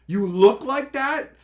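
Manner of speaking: high, slow, whiny envious fan voice; a hammed-up envious viewer; mock jealous fan. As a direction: whine it like a jealous bro online. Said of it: "They'd be like".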